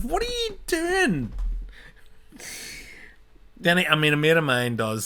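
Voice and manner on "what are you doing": much higher, slow, disbelieving; incredulous squeal. Retold or imitated. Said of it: "It's like".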